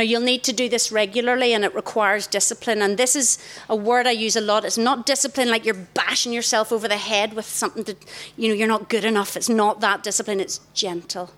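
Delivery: ordinary delivery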